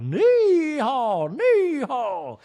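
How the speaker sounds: high loud braying; donkey bray. Retold or imitated. Guard donkey warning off a drunk guest, loud bray. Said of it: "Santos will be like".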